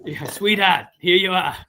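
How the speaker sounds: Boston counter server, dropping the r's; Boston accent with a little extra lift; put-on Boston accent. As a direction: play a Boston donut-shop worker. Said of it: "a Boston accent".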